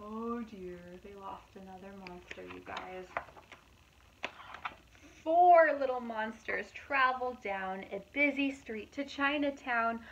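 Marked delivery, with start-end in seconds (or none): 5.2-10.0 lively, louder storybook narration; reading to children. A narrator's reading. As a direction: read it out brightly to a young audience. Reading picture book aloud.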